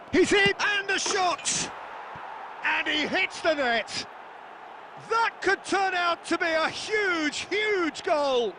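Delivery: high, rising shout of a goal call; excited goal call. Acted. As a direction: call the goal like a thrilled commentator. Commentator calls a goal.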